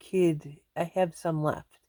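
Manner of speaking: ordinary talk, nothing marked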